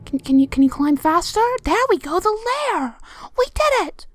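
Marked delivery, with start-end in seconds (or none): 3.4-4.1 high, excited victory call; triumphant cheer. Real, not acted. Player cheering as the chapter is cleared, excited.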